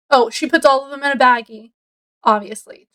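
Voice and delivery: normal talking voice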